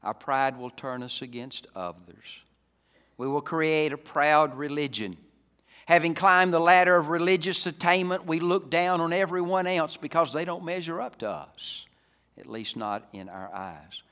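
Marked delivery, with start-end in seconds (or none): 3.2-11.8 loud, high-pitched preacher's proclamation; raised, emphatic pulpit voice; forceful preaching. Real, not acted. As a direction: ring the charge out to the congregation.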